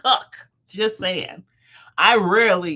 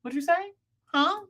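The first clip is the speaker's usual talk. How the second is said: confused Gen Z; 10 semitones higher than usual. Retold or imitated. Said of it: "They're like".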